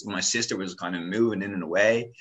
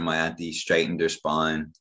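normal talking voice

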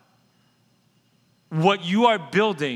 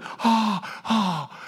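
flustered gasps; 3 semitones higher than usual. Acted. Fumbling the longed-for thing.

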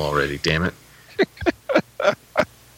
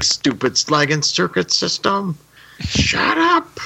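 high, rising mock rage into a shrieked shut up; going nuts; 11 semitones higher, slower than usual. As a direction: rant at the machines like a robot gone berserk. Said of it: "he just goes nuts again".

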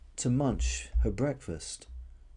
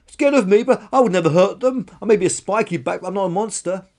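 high, loud wounded protest; indignant shouting; 9 semitones higher, 11 dB louder than usual. Acted. Dinosaur denies she would hurt anyone, indignant shouting. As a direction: protest loudly, easing off slightly. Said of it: "shouted Karen".

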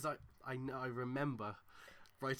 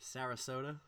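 American accent. Shown as a demonstration. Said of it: "I've gotta do this in an American one".

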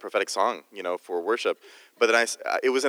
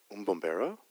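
soft, low murmur with a questioning rise; quietly wondering, as an imitated onlooker; hushed questioning; 4 semitones lower, 8 dB quieter than usual. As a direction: ask it under your breath, intrigued. First quiet retold questioning of crowd.

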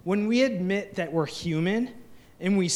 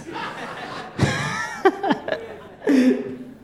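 an airy laugh that trails down; falling laugh; 8 semitones higher, 3 dB louder than usual. Real, not acted. Pastor laughing with the congregation at his own joke, airy falling laugh. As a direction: let the laugh tumble out after the punchline.

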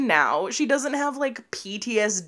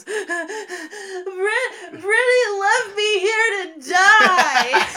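high, slow mock sobbing; hammed-up tearful wail, building louder; imitated crying sister; 11 semitones higher, 4 dB louder, much slower than usual. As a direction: wail it like a little kid in tears. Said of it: "my sister's crying, and she goes".

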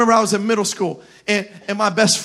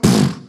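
punch sound effect. Acted. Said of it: "You like that sound effect?".

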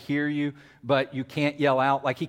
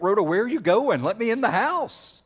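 Peter; 6 semitones higher than usual. Acted. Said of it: "I see myself as Peter".

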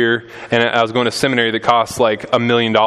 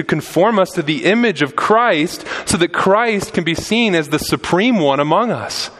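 higher, building sermon cry; impassioned preaching; 6 semitones higher than usual. Acted. Pastor urging his church, preaching at full force. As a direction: preach it out to the congregation.

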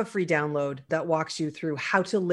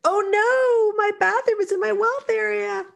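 play-acted horror, pitched way up; mock panic; 4 dB louder than usual. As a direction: wail the title in hammed-up alarm. Mock panic over episode title.

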